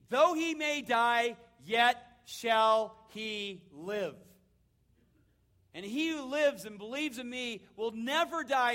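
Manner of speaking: loud, slow proclamation; preaching at full force. Acted. Preacher quoting Jesus to Martha, loud slow proclamation. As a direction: proclaim each phrase slowly and loud.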